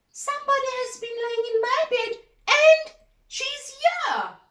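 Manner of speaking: put-on high, loud baby bear alarm; tiny wee bear cry. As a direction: squeal it out like the cub. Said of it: "cried little wee bear in her little wee voice".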